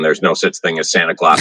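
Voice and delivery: normal talking voice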